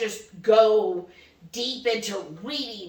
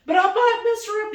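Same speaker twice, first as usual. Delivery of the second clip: higher, louder nervous protest; mock worried husband; 10 semitones higher, 5 dB louder than usual. Wife imitating her husband worrying, higher and louder. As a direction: play him fretting he'll hurt her.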